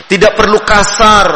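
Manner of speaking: usual speaking voice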